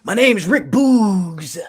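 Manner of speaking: slow, drawn-out announcer voice; hammed-up wrestler intro; Rick Boogs impression.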